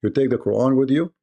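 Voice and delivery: ordinary delivery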